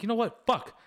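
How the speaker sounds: high, loud burst of resolve; fired-up self talk. Retold or imitated. Pumping himself up after the movie, high and loud. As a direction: snap yourself into gear.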